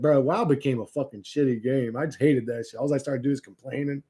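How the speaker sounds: ordinary talk, nothing marked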